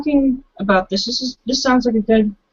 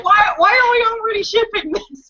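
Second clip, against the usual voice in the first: high teasing squeal; 9 semitones higher than usual. Real, not acted. Teasing about ship.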